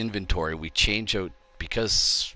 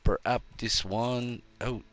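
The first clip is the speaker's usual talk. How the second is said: hammy French chef voice; playful outrageous French accent; 3 semitones higher than usual. Pretend. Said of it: "I am French".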